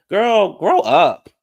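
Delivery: slow, high, loud scolding; exasperated outburst. Acted. Vlogger scolding a contestant who cried again, exasperated and loud. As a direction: holler it at her, fed up. Reacts to her crying again.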